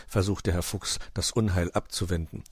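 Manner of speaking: ordinary delivery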